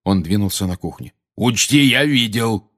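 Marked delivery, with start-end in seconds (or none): 1.4-2.7 slow, mildly angry rebuke; gruff, somewhat angry warning; cross warning. A narrator's reading. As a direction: draw it out crossly, pitch raised. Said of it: "сердито добавил он".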